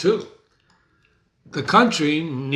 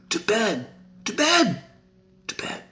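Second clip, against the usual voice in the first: high voice dying away to nothing; fading to bed; 8 semitones higher than usual. Acted. Lady Macbeth drifting off to bed, voice fading away. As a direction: let each to bed fade further.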